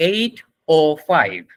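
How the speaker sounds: very slow, word by word; model time reading. Shown as a demonstration. Teacher introducing the last time, slow demonstration. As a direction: space out "eight oh five". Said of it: "এটা হচ্ছে".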